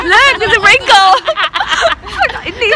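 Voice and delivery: high giggling voice; laughing. Real, not acted. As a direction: laugh through the words. Laughing at her own face.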